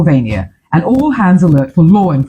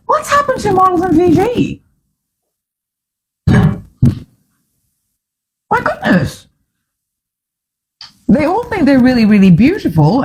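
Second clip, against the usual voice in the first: exasperated; 12 semitones higher than usual. Real, not acted. Exasperated at models in Fiji.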